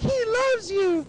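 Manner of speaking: very high, drawn-out mock wail; screechy imitation of a tearful mother; hysterical mom. Mom defending the lover to son, high mock-hysterical cry.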